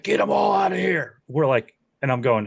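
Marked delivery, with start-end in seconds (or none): 0.0-1.2 mock bossy shout. DP orders the track braces removed.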